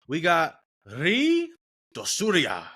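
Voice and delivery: Ri drawn out slowly with a put-on foreign flourish, then Dosuria; slow, showy put-on accent on Ri Dosuria; added accent. Reading an unfamiliar fighter's name to viewers, with a put-on accent. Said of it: "I had to add the accent to it".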